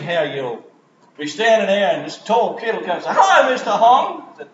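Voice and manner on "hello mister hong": higher, brighter greeting of a grown student; lightly voiced grown-up pupil saying hello; former student. Tall former student greets teacher.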